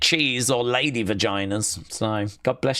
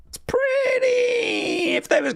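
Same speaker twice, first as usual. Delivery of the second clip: squealed mock outburst; slower than usual. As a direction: blurt it as a shrieking tease. Teasing about kinky Mountie.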